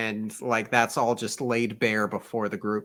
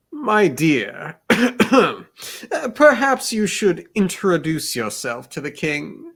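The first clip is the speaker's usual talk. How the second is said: flustered gentleman; 6 semitones higher, 6 dB louder, slower than usual. Acted. Breeze flustered, clearing throat.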